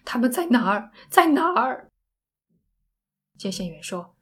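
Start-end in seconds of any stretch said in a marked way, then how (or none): 0.0-1.9 loud, desperate, pitch climbing; near breakdown. Acted. Sandy asking where help is, near breakdown. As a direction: demand it as if about to collapse. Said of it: "山迪快崩溃了".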